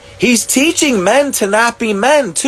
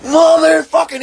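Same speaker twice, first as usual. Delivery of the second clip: mock angry outburst; 9 semitones higher than usual. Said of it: "you're like".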